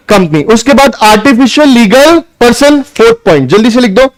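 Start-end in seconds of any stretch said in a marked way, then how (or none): none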